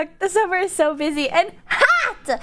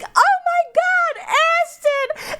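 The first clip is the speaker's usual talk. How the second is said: much higher, slow squealing fan; excited fan shriek; much slower than usual. Retold or imitated. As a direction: squeal her name like a starstruck fan. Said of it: "they go like".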